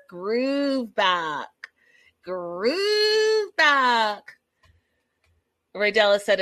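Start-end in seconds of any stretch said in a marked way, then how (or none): 0.1-4.4 drawn-out, high, crooning cheer; playful hype. Real, not acted. Vlogger cheering on Debbie's date, playful drawn-out hype. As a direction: stretch out the vowel and whoop it playfully.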